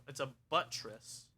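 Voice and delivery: ordinary delivery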